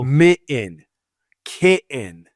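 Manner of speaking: MITTEN and KITTEN with the T dropped; swallowed double T. Retold or imitated. Showing how Heidi talks, mitten and kitten without T. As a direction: leave a catch for the TT in mitten, kitten. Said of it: "she doesn't pronounce it".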